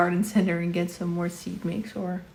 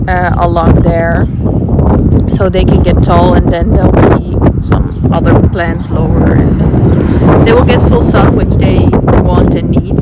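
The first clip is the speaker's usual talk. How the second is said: loud, raised voice outdoors; shouting over wind; 14 dB louder than usual. Real, not acted. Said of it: "I hope you can hear me over the wind".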